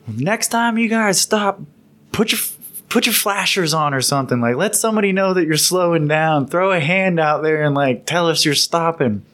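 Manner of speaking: high, exasperated imitation of the captain, easing down; retold scolding. Captain lectures Humvee crew on stopping, imitated scolding. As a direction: lecture them in a high mock-angry voice.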